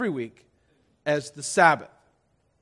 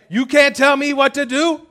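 mock defiance; 10 semitones higher, 9 dB louder than usual. Mock rebellious protest.

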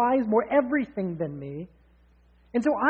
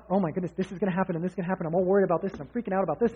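rapid, breathless tumble of worries; frantic worrying; 2 semitones lower, much faster than usual. Retold or imitated. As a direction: rush it out like you're panicking. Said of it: "all frantic".

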